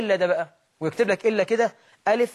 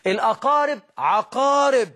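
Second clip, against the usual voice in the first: الأقارب عقارب said slowly and a bit louder, in a put-on mocking voice; mocking the proverb; 5 semitones higher, 3 dB louder than usual. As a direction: drawl out الأقارب عقارب as a mocked saying. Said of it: "بيقولوا كده".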